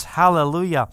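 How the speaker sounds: normal talking voice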